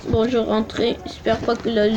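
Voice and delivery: usual speaking voice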